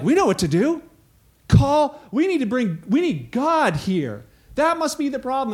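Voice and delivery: excited scheming, a bright idea; eager Israelites. Retold or imitated. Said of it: "And they're like".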